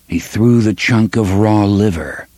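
usual speaking voice

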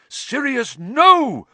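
high, loud desperate yell. A narrator's reading. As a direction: shout to stop him. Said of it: "Lupin yelled".

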